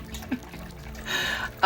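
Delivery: a soft breathy giggle; quiet laugh. Real, not acted. Reader overwhelmed by the cards, soft laughter. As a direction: laugh under your breath.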